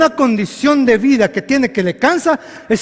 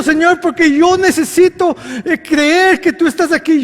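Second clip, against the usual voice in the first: higher, louder prayer cry; loud cry to God; 6 semitones higher, 5 dB louder than usual. Acted. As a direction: call out to the Father. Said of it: "dijo en voz fuerte". (clamor fuerte de Jesús al Padre)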